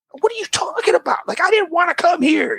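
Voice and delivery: high-pitched, incredulous comeback; indignant protest. Retold or imitated. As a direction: argue back like an upset kid. Indignant child answers dentist's blame.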